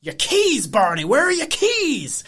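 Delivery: high, slow wavering Southern-ish voice drifting into Doc Brown; hammed-up accidental Christopher Lloyd; Christopher Lloyd impression. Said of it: "you became Christopher Lloyd there".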